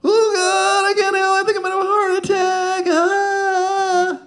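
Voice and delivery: high, gasping mock panic at the bottom; panicked rider. Rider reaching the bottom of the hill, in shrill mock panic. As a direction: squeal like your heart's giving out. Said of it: "he's like".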